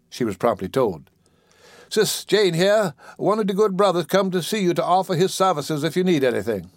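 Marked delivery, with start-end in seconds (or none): 1.9-6.7 high-pitched dialect voice of a lively caretaker; animated caretaker. Acted. Caretaker announcing a church brother to the patient, animated. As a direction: announce the visitor in a raised voice.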